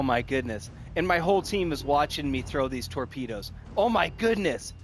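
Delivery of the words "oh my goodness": excited. Real, not acted. Torpedoes land, team watching.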